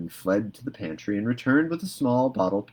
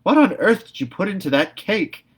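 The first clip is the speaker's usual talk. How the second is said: high, loud and appalled; indignant demand; 11 semitones higher, 7 dB louder than usual. A narrator's reading. Marilla demanding Anne explain the cake, high and appalled. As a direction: demand an answer in dismay.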